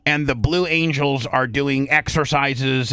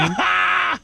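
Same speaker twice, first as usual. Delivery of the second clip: a sudden high laugh; real laugh, pitched way up; high burst of laughter. Real, not acted. Laughing at retold insult.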